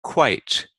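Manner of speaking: QUITE with the long i leaned on; stressed vowel. Shown as a demonstration. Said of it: "we always stress the vowel".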